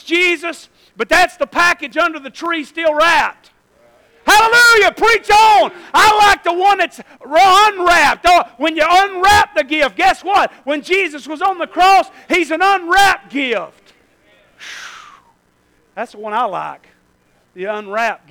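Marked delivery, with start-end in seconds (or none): 4.3-13.9 much higher and louder, near shouting; shouted praise. Real, not acted. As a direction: lift the whole room with joy. Shouting climax of sermon.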